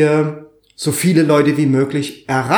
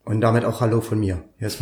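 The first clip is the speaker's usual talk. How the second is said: lower, softer, sonorous voice-over tone; 5 semitones lower, 5 dB quieter, faster than usual. Real, not acted. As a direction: rich voice from off-mic. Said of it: "die sonore Stimme aus dem Off".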